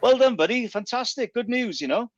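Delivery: high, sing-song mock congratulations; mocking friends' hollow congratulations, high and sarcastic; sarcastic mock praise. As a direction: gush it with heavy sarcasm, pitched well up.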